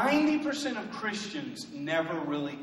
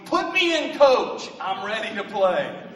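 belted out high and loud like a rally cry; shouted song line; 4 semitones higher, 9 dB louder than usual. Pretend. Pastor shouting a proposed theme song to the church, loud and high. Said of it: "our new music theme song ought to be".

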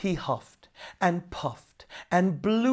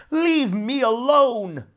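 loud, much higher, slow cry of a fed-up demon; shouted; 9 semitones higher, 7 dB louder, slower than usual. Acted. Water devil yells at Nora to leave him alone, loud high slow shout. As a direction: yell to get rid of her. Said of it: "shouted the devil".

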